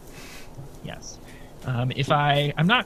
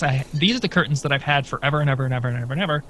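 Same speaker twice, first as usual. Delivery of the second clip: usual speaking voice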